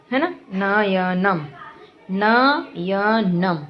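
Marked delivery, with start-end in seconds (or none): 2.1-3.6 slow demo. Shown as a demonstration. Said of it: "तो इसमें जैसे इसमें दिया गया है".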